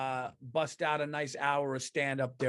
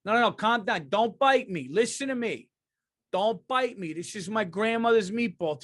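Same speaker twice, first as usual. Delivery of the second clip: high, loud, pleading Italian guy; hammed-up Italian reasoning with a zombie; Italian mob guy; 7 semitones higher, 3 dB louder than usual. Italian guy feeding a zombie meatballs, urgent mock pleading. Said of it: "Italians are trying to feed the zombies".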